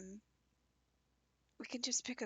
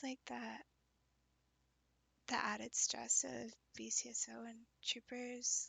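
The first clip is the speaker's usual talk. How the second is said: soft unvoiced whisper; 3 dB quieter than usual. Real, not acted. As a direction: whisper it close and gentle. Speaker whispers all lines.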